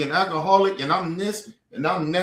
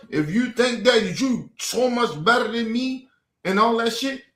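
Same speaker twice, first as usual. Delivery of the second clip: ordinary talk, nothing marked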